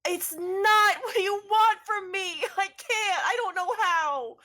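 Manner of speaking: high, loud, slow cry of despair; anguished wail. Acted. John answering the party's call, high anguished wailing. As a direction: wail it out like a breaking man.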